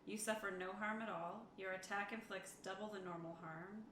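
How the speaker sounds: usual speaking voice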